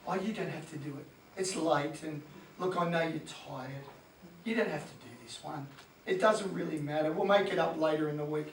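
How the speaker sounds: hammed-up soft, indulgent coach voice.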